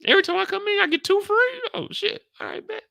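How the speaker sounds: very high, loud squeal of disbelief; mock shocked delight. At the bar about two free drinks, very high squeal. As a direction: shriek it up high like you can't believe it. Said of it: "I'm like".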